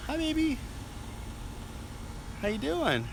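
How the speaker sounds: high, soft cooing to a pet. Real, not acted. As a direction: coo at the cat sweetly. Talking sweetly to the cat.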